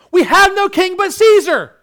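very high, very loud cry; crowd's defiant shout. Acted. Crowd answering Pilate about their king, defiant shout.

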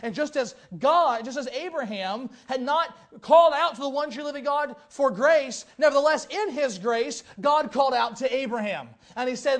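loud, rising sermon delivery; full-force preacher voice; fervent preaching. Acted. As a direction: drive the point home to the congregation.

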